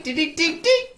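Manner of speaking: ordinary talk, nothing marked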